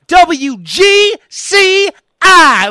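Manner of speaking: professional voice person. Retold or imitated. Listener names favorite station, polished drawn-out announcer delivery. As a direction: spell it out with great conviction like radio talent. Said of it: "they sound like it's actual voice person".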